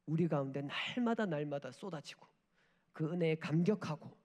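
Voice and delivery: ordinary delivery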